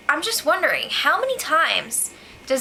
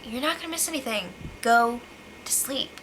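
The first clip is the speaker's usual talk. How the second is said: hushed Derek; 6 dB quieter than usual. Retold or imitated. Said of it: "he was just like".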